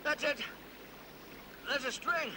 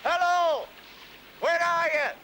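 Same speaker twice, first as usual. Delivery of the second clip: loud, carrying search call; 2 semitones higher, 3 dB louder than usual. Acted. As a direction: hail someone lost out of sight. Searcher calls through fog.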